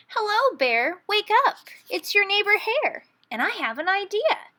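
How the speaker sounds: high, bright-eyed trickster voice; cheery Hare. A narrator's reading. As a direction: Hare waking his neighbor with a sly grin. Hare's voice in story.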